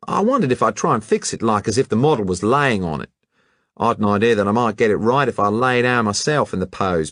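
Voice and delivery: breezy colonial lad; Hatchett's Australian voice. Acted. Hatchett answering the detective casually, brash Aussie voice.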